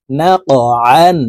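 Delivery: ordinary delivery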